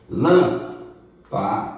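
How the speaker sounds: "Lâmpada" very slow, split lâm-pa-da; syllable by syllable. Shown as a demonstration. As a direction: count out the syllables of "Lâmpada" with pauses. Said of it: "Último, penúltimo, antepenúltimo". ("Lâmpada" devagar, separando as sílabas)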